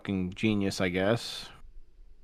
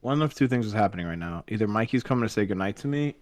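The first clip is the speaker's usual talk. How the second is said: usual speaking voice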